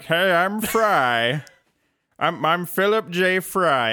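a slow, lightly voiced cartoon Fry; self-conscious stab at a Fry impression. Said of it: "my best Fry voice".

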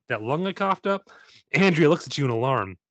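normal talking voice

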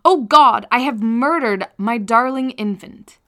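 a loud cry of horror, then slowing down; anguished exclamation. Acted. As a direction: cry it out as a mother who has just realised her guilt.

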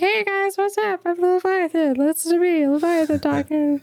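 high, chirpy goofy monster voice; silly casual Leviathan. Pretend. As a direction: play a huge beast as a chatty teen. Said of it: "it'd be silly if the Leviathan was like".

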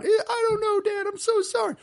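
very high, loud mock wail; imitated crying. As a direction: blubber an apology like a caught kid. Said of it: "I'm crying".

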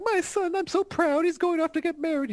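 very high gushing mom voice; proud mother. Acted. Mother bragging about her son, high and gushing. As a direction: gush in a squeaky mock-maternal falsetto.

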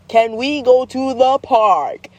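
slow, drawn-out stagey request; hammed-up, deliberate plea; theatrical child. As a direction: imitate a kid overacting every word. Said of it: "theatrical way of all times".

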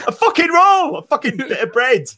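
high-pitched comic outburst with laughter behind it; hammed-up, high exasperated cry; exasperated mock yell. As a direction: yell it in mock exasperation at not being understood. Exasperated answer in retold exchange.

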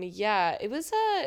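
usual speaking voice

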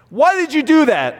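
sharp, high, accusing "Why did you do that?"; exasperated parent snapping "Why did you do that?"; accusing question. Retold or imitated. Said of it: "I'm making several statements right there".

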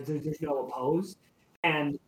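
ordinary talk, nothing marked

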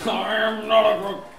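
mock Nixon denial; Nixon impression. Nixon quote mocked, laughing.

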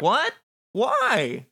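high, bewildered exclamations; shocked. Acted. Ephemer's friend seeing the player attack, shocked cries.

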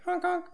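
high squeaky clown horn honks; silly clown. Pretend. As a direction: honk like a clown nose. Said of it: "I have my clown nose on, and I'm honking it".

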